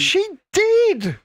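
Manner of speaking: high-pitched indignant protest; emphatic insistence. Real, not acted. Insisting against repeated contradiction.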